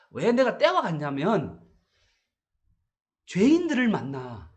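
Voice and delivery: usual speaking voice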